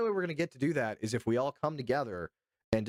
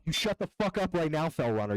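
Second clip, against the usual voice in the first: higher, louder fake outrage at a viewer; mock angry yell; 7 semitones higher, 3 dB louder than usual. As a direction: snap at the chatter in playful fury. Mock yelling at chatter.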